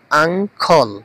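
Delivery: "আঙ্কল" in two clear parts, আং-কল; pronunciation demo. Shown as a demonstration. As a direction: say "আঙ্কল" as ang-khol, teaching it. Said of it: "এটার উচ্চারণটা হবে". ("আঙ্কল" আং-খল উচ্চারণে স্পষ্ট করে)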